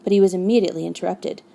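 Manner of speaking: usual speaking voice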